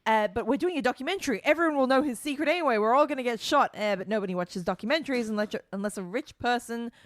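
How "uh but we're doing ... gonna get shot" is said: lightly voiced, higher-pitched quote; mock plot summary. Podcaster parodying the episode's documentary premise, mock protest. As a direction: imitate the characters, pitch climbing as it goes. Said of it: "The whole thing was".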